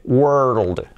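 WORLD drawn out through R, L and D; sounds joined up. Shown as a demonstration. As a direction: blend WORLD slowly from its parts. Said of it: "la R y la D".

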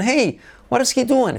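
"hey, what is he doing?" with a high "hey" and a falling end; question intonation. Shown as a demonstration. Teacher modelling the tune of the question. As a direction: start "hey" up high, then let "what is he doing?" fall. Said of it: "Entonación".

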